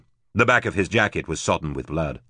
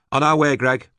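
brisk reply, raised up high; higher woman's voice; 8 semitones higher, 4 dB louder than usual. A narrator's reading. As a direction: answer quickly over the radio. Rachel answering over the fax.

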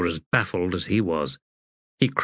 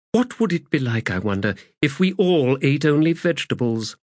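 higher-pitched, wondering character voice; musing Aranict; 5 semitones higher, faster than usual. Acted. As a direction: wonder aloud to a companion.

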